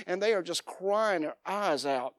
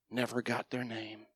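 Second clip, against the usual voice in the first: softer echo; 7 semitones lower, 11 dB quieter than usual. Real, not acted.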